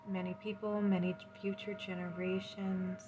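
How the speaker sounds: usual speaking voice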